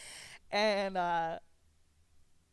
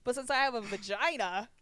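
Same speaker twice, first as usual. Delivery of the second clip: mock indignant rant; 5 semitones higher, 4 dB louder than usual. Indignant retold rant at friend.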